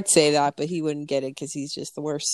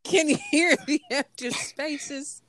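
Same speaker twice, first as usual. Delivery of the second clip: high-pitched belle imitation with a giggle; Southern belle; 9 semitones higher than usual. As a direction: mimic the Southern lady, amused. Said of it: "Southern belle".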